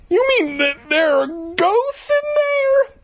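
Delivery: put-on very high, slow squeaky kid; child hero voice. Hero asking Louisa about ghosts, squeaky kid voice. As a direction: squeal the question, drawing it out.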